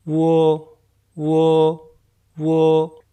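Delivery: "वो" as "वू" joined to "ओ"; blended uo. Shown as a demonstration. Teacher joins "वू" and "ओ" into "वो". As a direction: glide "वू" into "ओ" for "वो". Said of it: "तो क्या बनेगा".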